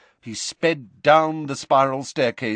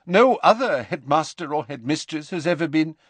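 higher-pitched formal professor voice; stern headmistress figure; 7 semitones higher, 4 dB louder than usual. Acted. McGonagall on the ministry's say, formal and higher. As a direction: speak formally, then break off.

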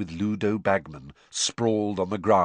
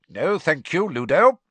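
high, loud and curt; bite of impatience; 8 semitones higher, 7 dB louder than usual. A narrator's reading. Crouch declining Ludo's seat, impatient. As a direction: refuse politely with an edge. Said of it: "bite of impatience in his voice".

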